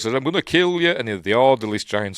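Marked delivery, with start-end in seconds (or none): 0.1-0.9 loud, high acted threat; mock angry threat. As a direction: threaten as if coming to blows. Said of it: "nearly gonna come to blows".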